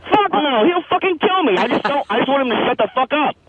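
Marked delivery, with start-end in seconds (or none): 0.1-1.6 high-pitched, agitated backing down; real worked-up refusal; alarmed refusal. Real, not acted. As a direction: protest at a jump in pitch. Caller backs down from fight.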